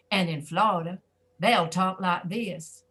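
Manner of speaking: slow, drawn-out Southern drawl from Florida; Florida Southern accent. Shown as a demonstration. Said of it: "They don't talk like we do".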